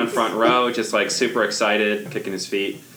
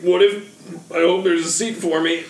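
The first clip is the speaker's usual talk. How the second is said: flustered, high, loud showman; overbright kids party host; 10 semitones higher, 4 dB louder than usual. Acted. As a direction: stumble in then sell the magic. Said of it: "be like".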